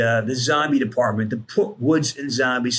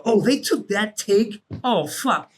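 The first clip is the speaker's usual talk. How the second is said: retold dismay; 8 semitones higher, slower than usual. Reacting to hearing his own recorded voice.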